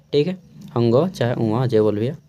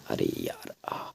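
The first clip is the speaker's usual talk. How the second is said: groaning sigh; 12 dB quieter, slower than usual. Real, not acted. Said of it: "कमर दर्द करने लगता है बैठ बैठ के".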